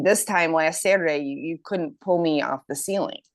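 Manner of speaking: ordinary talk, nothing marked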